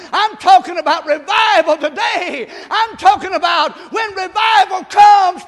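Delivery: loud, high, drawn-out revival cries; full-force preaching. Real, not acted. Pastor calling the church to seek revival, full-force preaching. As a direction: belt it out to rouse the congregation.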